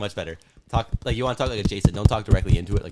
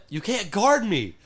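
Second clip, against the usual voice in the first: mock possum taunt; 9 semitones higher, slower than usual. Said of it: "that's what he was saying".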